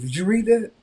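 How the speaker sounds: father's voice jumps high and loud; a pushy dad cornering him; loud demanding father. Retold or imitated. Father demanding an answer, loud and high.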